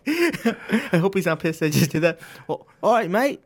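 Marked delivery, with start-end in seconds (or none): 2.5-3.4 mock British accent, pitched up and louder; a quick hammed-up [name] Brit. Shown as a demonstration. Asked by the host for a British accent, hammed-up British accent. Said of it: "how about British now".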